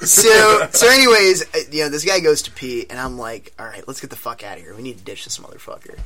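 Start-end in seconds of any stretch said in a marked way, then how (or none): 3.6-6.0 low, quick hushed plotting; hushed urgent aside. Retold or imitated. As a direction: mutter it fast so he won't hear. Sneaking out while he pees.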